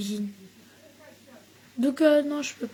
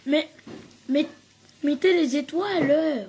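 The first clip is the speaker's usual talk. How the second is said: louder insistent demand; insistant plus fort; 3 semitones higher, 7 dB louder than usual. Real, not acted. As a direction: call out the request. Redemande les étoiles, plus fort. (demande appuyée, plus forte)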